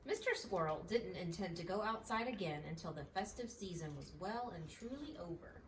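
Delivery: plain reading voice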